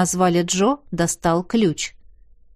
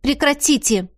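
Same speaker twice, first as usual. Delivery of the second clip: sharp command; 6 semitones higher than usual. Acted. Heroine orders jailers to stop.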